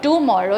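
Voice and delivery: "tomorrow" starting with a full "too"; overpronounced first vowel. Shown as a demonstration. Teacher showing a mistake to avoid, "tomorrow" with full "too". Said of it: "The t is not".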